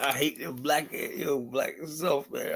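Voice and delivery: slow, soft mocking echo of the clip; mock quote. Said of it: "that's hysterical".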